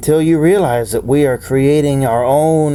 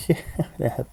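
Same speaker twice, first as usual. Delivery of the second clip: laughing whisper; 4 semitones higher, 9 dB quieter than usual. Acted.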